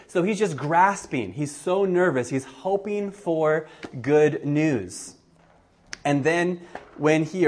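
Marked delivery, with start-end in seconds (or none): none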